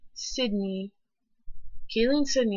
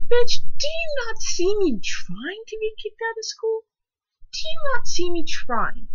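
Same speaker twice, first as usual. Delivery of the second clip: high, loud sarcastic outburst; student mocking the principal's cheerleading excuse, yelling high and loud; furious rant; 7 semitones higher, 8 dB louder than usual. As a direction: let the principal have it, sneering and shouting. Mock ranting at the principal.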